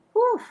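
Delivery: big loud whew of relief, high; relieved whew. Real, not acted. Vlogger unloading the stress of the year, loud whew. Exhale after heavy thought.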